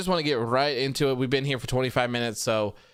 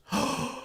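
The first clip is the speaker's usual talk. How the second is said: mock gasp; 11 semitones higher, 4 dB quieter than usual. Mock shock at chat joke.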